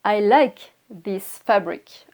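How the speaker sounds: teacher's slow, clear example; slow model sentence. Shown as a demonstration. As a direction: spell it out slowly word by word. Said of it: "je peux dire".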